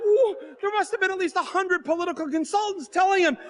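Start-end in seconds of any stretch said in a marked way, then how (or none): none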